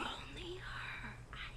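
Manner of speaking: slow, breathy whisper. Real, not acted. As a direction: whisper it slowly. Whispered aside.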